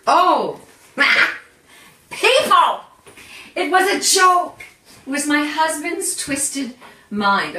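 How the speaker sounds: loud, high mock outrage; exasperated outburst. Real, not acted. Host explaining the clock joke to viewers, exasperated. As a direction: cry out in laughing disbelief.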